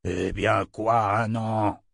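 slow, mildly angry tyrant's question; understated imperious growing fury; mild roar. A narrator's reading. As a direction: demand it with drawn-out impatience. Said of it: "rugit Jagang".

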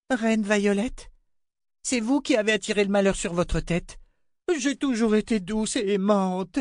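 put-on a child saying sorry to the queen; young girl Rachel. A narrator's reading. Girl apologizing to the queen, meek.

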